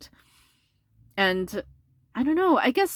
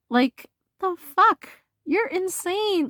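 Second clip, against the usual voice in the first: much higher, slow, drawn-out disbelief; incredulous; 11 semitones higher, slower than usual. Real, not acted. Reader reacts to the ex tossing her away, high and incredulous. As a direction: stretch it out like you can't believe it.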